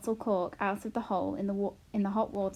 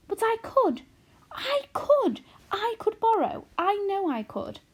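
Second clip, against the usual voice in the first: much higher, bursting with eagerness; eager insistence; 12 semitones higher, 6 dB louder than usual. A narrator's reading. Daughter pleading her case to her mother, eager and high. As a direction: insist she can, thrilled.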